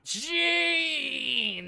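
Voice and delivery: high, loud cyclops bellow; dim giant cyclops. Acted. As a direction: roar the name like a dumb giant. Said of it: "I'll do my best Cyclops impression".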